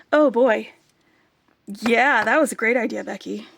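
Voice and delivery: excited self-praise. Real, not acted. Pleased with her own idea.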